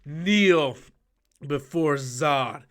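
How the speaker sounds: slow commanding villain voice; Zod impression. Fan quoting Superman villain, slow commanding voice.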